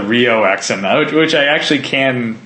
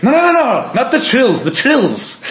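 Irish accent imitation; 13 semitones higher than usual. Irish kid insisting on the band name, hammed-up Irish accent. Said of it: "He's like".

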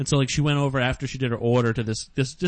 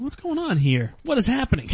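bewildered; 11 semitones higher than usual. Retold or imitated. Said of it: "I was like".

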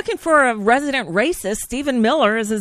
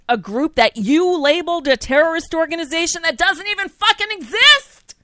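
real anger; 4 semitones higher than usual. Real, not acted. Co-host ranting about the president's tweet, angrily building. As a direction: build in indignation to an exasperated outburst. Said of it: "it makes me so angry".

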